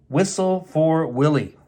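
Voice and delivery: usual speaking voice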